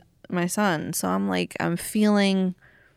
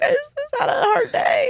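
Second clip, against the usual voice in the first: crying retold. Mom reenacting her crying call to a friend, high teary wail. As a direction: wail it out through tears. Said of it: "I was like".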